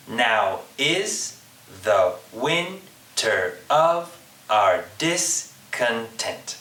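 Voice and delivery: slow "Now is the winter of our discontent", beat by beat; unstressed then stressed syllable through "Now is the winter of our discontent"; iambic stress. Shown as a demonstration. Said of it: "an example of a famous Shakespeare line that's in iambic pentameter".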